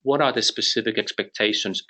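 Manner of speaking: usual speaking voice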